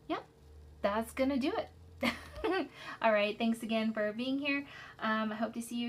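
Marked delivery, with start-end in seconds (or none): 1.7-3.0 high laugh. Real, not acted. Laughs at the end of video.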